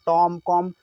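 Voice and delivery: "टॉम", "कॉम" ending on a long m, no b; silent B. Shown as a demonstration. Teacher showing students the right reading, silent b. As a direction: hum out the final m and stop. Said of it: "ऐसा पढ़िए".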